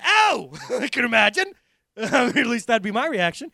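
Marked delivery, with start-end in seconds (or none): 0.0-0.5 high, loud cry of pain; hammed-up yelp of pain. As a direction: yelp as the leg is crushed. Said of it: "And Balaam's like".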